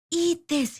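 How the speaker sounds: high shout to lure them. A narrator's reading. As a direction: bait the birds. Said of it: "he shouted".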